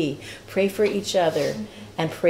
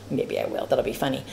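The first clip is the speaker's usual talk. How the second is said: softer, lower, breathy tease; whispered aside; 5 semitones lower, 9 dB quieter than usual. Real, not acted. A whispered joke to the class about holding up a sign. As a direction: let the joke slip out under the breath.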